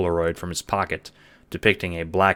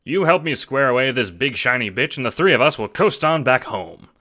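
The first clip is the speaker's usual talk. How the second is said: higher, louder, fast-talking pitch; eager schemer; 7 semitones higher, 6 dB louder than usual. Acted. Jacob pitching the assassination job, eager and hustling. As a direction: sell the plan like a hustler.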